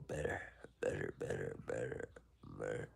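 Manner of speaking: drowsy trailing murmur; sleepy fading murmur. Real, not acted.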